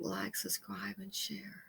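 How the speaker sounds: soft whispered sign-off. Real, not acted. Trailing off to whisper.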